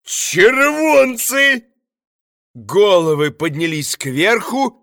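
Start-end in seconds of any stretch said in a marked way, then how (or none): 0.0-1.7 high, long joyful shout; joyful cry. A narrator's reading. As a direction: cry out with joy. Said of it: "послышался радостный голос".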